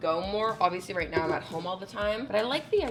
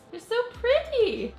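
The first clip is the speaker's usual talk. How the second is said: gushing; 5 dB louder, slower than usual. Real, not acted. Gushing over flowers.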